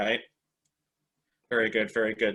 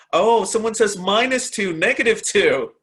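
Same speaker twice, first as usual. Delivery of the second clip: higher and louder, delighted; light up at a silly answer; gleeful call-out of a joke answer; amused excitement; 7 semitones higher, 6 dB louder than usual. Real, not acted. Reading a funny chat answer.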